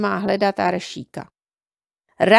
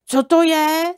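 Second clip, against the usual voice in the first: scared; 7 semitones higher, 4 dB louder, slower than usual. A narrator's reading. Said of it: "zeptal se a třásl se strachy".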